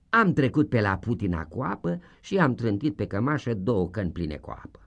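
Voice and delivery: usual speaking voice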